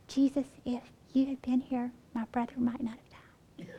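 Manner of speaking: hushed, fading into a whisper; quiet Mary. Retold or imitated. Little quiet Mary speaking to Jesus, hushed and whispery. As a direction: say it softly and timidly, trailing to a whisper. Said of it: "little quiet old Mary".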